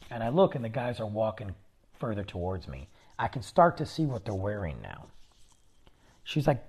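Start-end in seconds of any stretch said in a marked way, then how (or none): none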